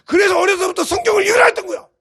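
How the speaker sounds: high-pitched, full-force preaching; exhorting shout. Real, not acted. Preacher insists on Bible reading from childhood, exhorting shout. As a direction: hold the shout through the whole line.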